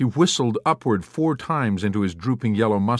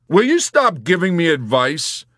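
loud, high-pitched irritation; fed up; 8 semitones higher, 6 dB louder than usual. A narrator's reading. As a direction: snap it at him, fed up. Yossarian fed up with advice.

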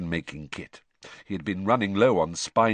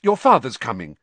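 sudden; 6 semitones higher, 8 dB louder than usual. A narrator's reading. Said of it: "she said suddenly".

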